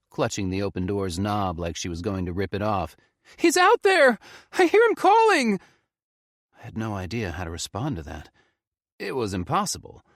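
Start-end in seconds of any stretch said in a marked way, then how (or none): 3.3-5.6 high, loud desperate yell; frantic shout. Acted. Faye yells at Felix that grandpa is calling, loud. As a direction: insist wildly.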